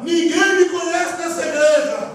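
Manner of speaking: high, projected preacher's cry; shout from the altar. Retold or imitated. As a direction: call it out across the church. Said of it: "ele gritou lá do altar".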